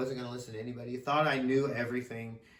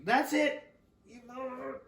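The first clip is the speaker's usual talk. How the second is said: correcting the mother's quote, higher pitch; mom, retold again; 11 semitones higher than usual. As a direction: redo the mother's line, higher and biting. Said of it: "or excuse me".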